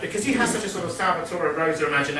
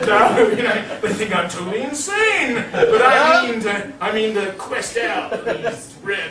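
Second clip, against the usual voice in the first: higher, louder, laughing through the words; laughing professor; 5 semitones higher, 5 dB louder than usual. Retold or imitated. The professor vowing to investigate, laughing. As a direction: giggle through the professor's vow.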